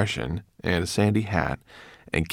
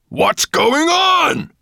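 long-drawn, high-pitched roar; 8 dB louder, much slower than usual. A narrator's reading. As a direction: roar the question, dragging it out. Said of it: "roared Nettlebrand".

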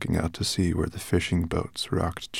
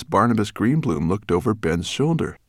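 ordinary delivery

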